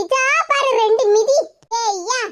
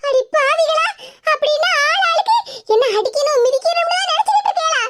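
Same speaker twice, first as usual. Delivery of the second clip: higher, half-laughing shocked exclamation; shocked outcry; 6 semitones higher than usual. Acted. Sister-in-law catches the plotters scheming against her, shocked. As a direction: gasp it out in mock outrage.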